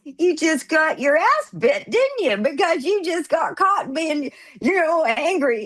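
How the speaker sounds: high, drawn-out mock scolding; singsong gloating at a friend; teasing. Friends tease Donnie caught being angry, gloating.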